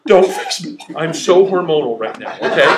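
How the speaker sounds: loud, laughing mock outburst; laughing protest. Husband to wife in the car, loud laughing protest. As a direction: shout it back through laughter.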